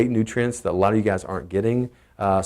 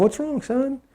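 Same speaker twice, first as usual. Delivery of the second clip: concerned dad; 13 semitones higher, 4 dB quieter, slower than usual. Retold or imitated. Comforting upset son.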